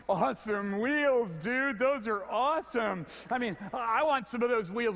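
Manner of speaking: impressed passersby. Retold or imitated. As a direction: gush like a stranger who loves the wheels. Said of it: "People going".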